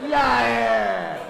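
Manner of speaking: high shouted hag jeering; Princess Bride old hag. Retold or imitated. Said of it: "You sound like that chick, remember, uh, Princess Bride?".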